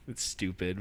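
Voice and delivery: normal talking voice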